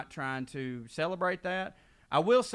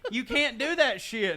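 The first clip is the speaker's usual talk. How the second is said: much higher, louder, laughing reprimand; laughing mock scold; 9 semitones higher, 6 dB louder, slower than usual. Scolding Wade while laughing, much higher and louder. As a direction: scold him while cracking up. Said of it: "And I was like".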